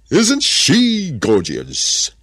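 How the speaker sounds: high, loud, long-drawn admiration; gushing serpent. A narrator's reading. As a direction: draw it out slowly in delighted wonder. Serpent admiring the newly seen woman.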